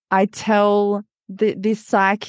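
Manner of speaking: usual speaking voice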